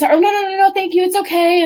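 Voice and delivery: high, fluttery brush-off; polite refusal. Retold or imitated. Someone offers lunch and you decline, high fluttery brush-off. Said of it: "that you just".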